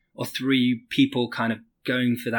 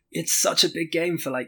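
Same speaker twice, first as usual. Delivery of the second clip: normal talking voice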